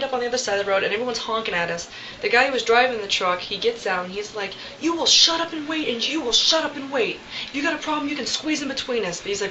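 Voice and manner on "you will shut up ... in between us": higher-pitched mock telling-off, firmly paced out; imitated angry scolding. Truck driver to honking cars, imitated angry scolding. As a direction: order them to wait, imitating an irate driver.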